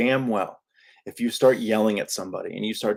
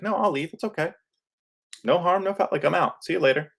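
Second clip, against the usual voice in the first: breezy exit line; 8 semitones higher than usual. Shown as a demonstration. Demoing leaving a disrespectful talk.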